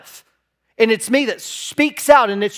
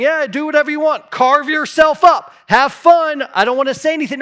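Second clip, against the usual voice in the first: mock permissive shrug; 6 semitones higher, 3 dB louder than usual. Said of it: "instead of just saying".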